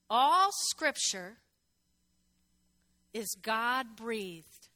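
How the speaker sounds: very slow, weighty reading; slow emphasis. Shown as a demonstration. Preacher reads scripture to the church, slowly. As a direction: lay each word down slowly. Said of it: "You gotta do this sometimes".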